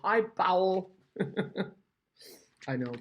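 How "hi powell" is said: loud, high, hammed-up "Hi, POW-ul"; exaggerated Dutch-style greeting. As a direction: belt out Powell as a mock-Dutch joke. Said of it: "now I exaggerate a little bit".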